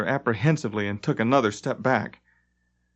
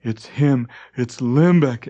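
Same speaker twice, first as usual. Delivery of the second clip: exclaimed; 5 dB louder, slower than usual. A narrator's reading. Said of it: "exclaimed Jarre".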